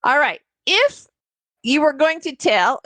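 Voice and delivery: ordinary talk, nothing marked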